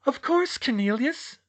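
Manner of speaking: high, falsely sweet agreement; put-on chirpy compliance, pitched high; forced smile. A narrator's reading. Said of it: "still forcing a smile".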